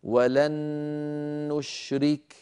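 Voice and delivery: "ولن نشرك" joined by one long humming n; doubled noon with ghunnah. Shown as a demonstration. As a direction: hold the n of "ولن" right into "نشرك". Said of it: "هكذا".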